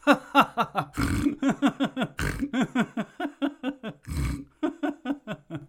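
snorting laugh like a pig; high snorting piggy laugh. Shown as a demonstration. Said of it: "the snort or the piggy laugh".

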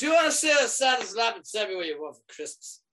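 loud, high hearty Santa shout; booming Santa offer. Retold or imitated. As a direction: hail her across the room as Santa.

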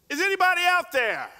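loud and high, calling up to the heavens; desperate shout to the sky. Acted. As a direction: yell it upward, clinging to the branch. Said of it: "lift up his voice to the heavens".

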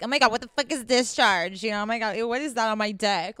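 imitating a squeamish guy, loud; hammed-up grossed-out outburst; mock freaked-out Joe Schmo. Mimicking an average guy confronted with discharge, mock freak-out. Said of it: "average Joe Schmo probably is like".

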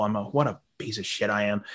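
normal talking voice

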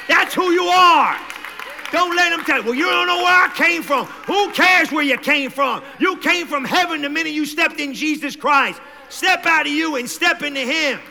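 loud, high preaching shout; full force preaching. Real, not acted. Pastor driving the congregation home, loud preaching.

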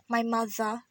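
usual speaking voice

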